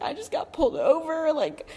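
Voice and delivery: whiny re-enacted crying; imitated tearful outburst. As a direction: retell it as a tearful wail to dad. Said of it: "I started crying".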